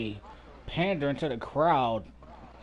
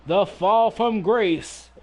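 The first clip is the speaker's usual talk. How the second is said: slow, higher, louder hype call; wrestling commentator; 6 semitones higher, 6 dB louder, slower than usual. Acted. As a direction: stretch out the big-move buildup. Announcing finisher setup.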